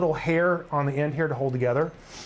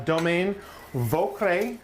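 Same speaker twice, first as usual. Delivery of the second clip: slow, careful French accent on the wine name; 2 semitones higher, much slower than usual. Real, not acted. Host tries the Chablis name for viewers, in a French accent. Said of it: "That was a good accent right there".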